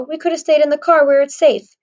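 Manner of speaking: annoyed complaint. A narrator's reading. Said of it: "he looks annoyed".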